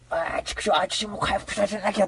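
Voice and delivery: a touch of a grumpy cat voice; lightly voiced grumbling cat. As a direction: mutter like the annoyed cat. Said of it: "ってこう".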